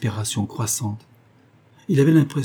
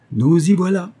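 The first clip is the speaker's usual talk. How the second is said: higher and louder, triumphant; 8 semitones higher, 7 dB louder than usual. Acted. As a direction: gloat as if you caught her out. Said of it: "dit-il avec un accent triomphant".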